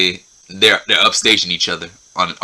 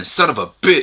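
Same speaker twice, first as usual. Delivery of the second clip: higher, lightly raised mock yell; 7 semitones higher than usual. Both men after drinking Everclear, mock pained yell. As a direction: blurt it like the burn just hit.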